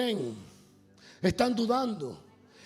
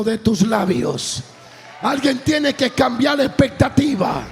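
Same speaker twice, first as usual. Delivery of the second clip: loud, rousing sermon delivery; rally the congregation with a projected shout; fervent preaching; 5 semitones higher, 13 dB louder than usual. Real, not acted. Preacher calling the church to declare change, fervent shouted preaching.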